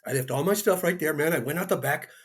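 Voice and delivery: ordinary delivery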